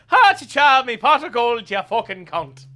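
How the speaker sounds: high, loud, hammed-up stage-Irish accent; mock Irish leprechaun. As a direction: cackle out a cartoon Irish leprechaun voice. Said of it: "we're over here going".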